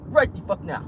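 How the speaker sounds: hammed-up African accent, punching each order. Host replaying investigators' orders, mock African accent. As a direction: give commands in a put-on African accent. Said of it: "we got an African accent when we need to".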